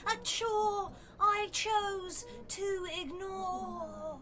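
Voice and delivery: a wife reciting a rueful poem about sex; poetry reading. Acted. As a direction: deliver each image like a dramatic verse. Reads her poetry aloud.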